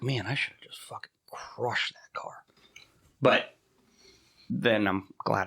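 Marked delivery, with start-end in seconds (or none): 0.0-2.4 breathy whisper of a dark thought; half-joking whispered grudge. Retold or imitated. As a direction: whisper it like a guilty fantasy. Retold angry thought, whispered.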